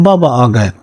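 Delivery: "بابا" stressed, then falling to a close; children's call, settling. A narrator's reading. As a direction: repeat "بابا آ گئے" gladly, landing down. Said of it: "بچے اس کو دیکھ کر دوڑ پڑتے اور کہتے". ("بابا" پر زور، آخر میں آواز نیچے آتی ہوئی)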